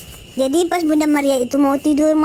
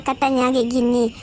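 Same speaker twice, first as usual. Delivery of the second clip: usual speaking voice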